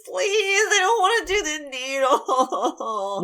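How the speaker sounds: high whiny sobbing plea; mock crying plea. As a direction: wail and beg like a big baby. Playing patient getting shot.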